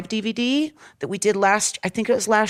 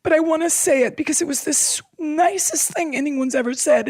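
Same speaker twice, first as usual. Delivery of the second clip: tearful; 7 semitones higher than usual. Real, not acted. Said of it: "I'm getting emotional".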